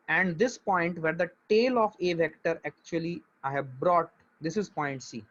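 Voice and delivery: very slow, deliberate teacher explanation; धीरे धीरे, बहुत धीमी आवाज़ में समझाते हुए; speaking slowly. Shown as a demonstration. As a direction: spell it out slowly so everyone follows. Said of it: "मैं बहुत धीरे धीरे बोल रहा हूँ".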